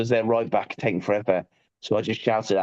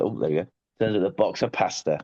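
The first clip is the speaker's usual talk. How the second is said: ordinary talk, nothing marked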